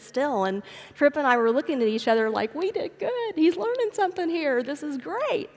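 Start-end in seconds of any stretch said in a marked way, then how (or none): 2.5-5.5 pleased parents congratulating themselves, bright and high; a mother voicing her own smug delight; proud parents. Mother recalls thinking with her husband, lightly pleased.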